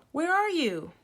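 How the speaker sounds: high loud call into the distance. A narrator's reading. Children call to the trapped girl, loud. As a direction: shout to locate her. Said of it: "we called".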